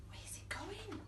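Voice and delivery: soft unvoiced whisper of wonder. Storyteller whispers to the kids as the bath runs off. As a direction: whisper it in awe.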